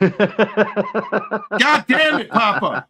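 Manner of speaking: drawn-out laughing curse; can't hold in the laugh; laughing groan. Real, not acted. Host reacts to a chat meme, laughing groan.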